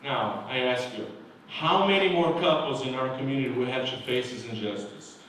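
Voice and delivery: ordinary delivery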